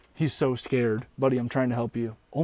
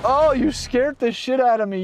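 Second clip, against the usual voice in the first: higher, louder startled yelp; jolted by a sudden butterfly; 10 semitones higher, 5 dB louder than usual. Real, not acted. Startled by a moth flying into the van, real yelp.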